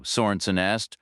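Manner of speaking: ordinary delivery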